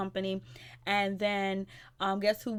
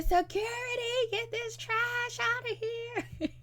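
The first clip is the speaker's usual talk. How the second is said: very high gleeful squeal of a summons; gleeful mock Ray; 14 semitones higher, slower than usual. Vlogger mimics Ray gleefully calling security, high squeal. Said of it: "He said".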